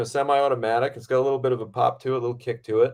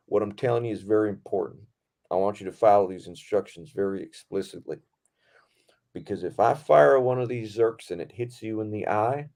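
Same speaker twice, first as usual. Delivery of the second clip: imitated Southern stunt coordinator; Southern accent. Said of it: "everyone's got a Southern accent".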